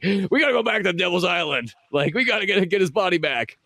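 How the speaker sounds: high-pitched mock-urgent plea; imitated movie line. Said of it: "he's like".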